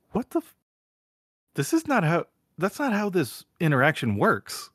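high-pitched baffled protest; mock incredulous. Punks reacting to a smashed window, incredulous.

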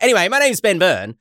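ordinary delivery

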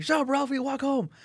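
high-pitched mock yell at a kid; retold coach's yell. Coach snapping back at the kid.

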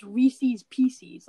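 "Reese's Pieces" as REE-seez PEE-seez; both "Reese's" and "Pieces" end in long -eez, slowly; rival pronunciation. Retold or imitated. Quoting classmates' argument, REE-seez PEE-seez. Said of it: "people that pronounce it Reese's".

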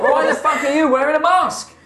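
high, loud incredulous yell; furious half-orc chef; outraged chef. Acted. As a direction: demand an explanation at full volume. Chef challenges masked intruder.

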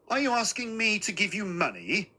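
mother's high-pitched, indignant question; stern and incredulous, pitched well up; suspicious challenge. A narrator's reading. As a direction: challenge him with haughty disbelief.

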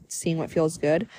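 ordinary talk, nothing marked

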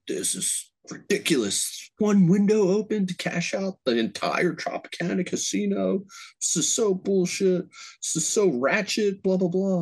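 high, whiny complaining rant; mock complaining guy. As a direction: imitate a customer griping about the wait. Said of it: "the guy starts bitching".

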